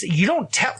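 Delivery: very high, strained mock shouting; imitated cheering yell. Wife shouting to her friend in the ring, mock yell. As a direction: impersonate a riled-up supporter yelling from bleachers. Wife yelling support at MMA match.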